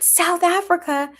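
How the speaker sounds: ordinary talk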